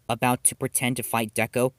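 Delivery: plain reading voice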